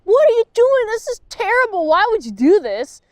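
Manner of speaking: high, loud, exasperated disbelief; mock outraged shout. Reviewer fakes yelling at the bad episode, high and loud. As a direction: shriek it like a fed-up viewer. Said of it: "I was not like".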